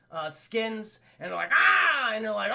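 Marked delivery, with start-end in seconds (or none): 1.5-2.1 loud high gargoyle roar; hammed-up waking roar; mock roar. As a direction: burst out like stone cracking to life.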